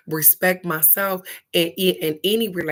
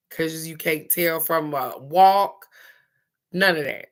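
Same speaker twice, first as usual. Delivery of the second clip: ordinary talk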